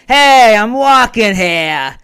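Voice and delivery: put-on slow, loud New York tough-guy accent. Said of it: "Can you judge my, uh, New York accent?".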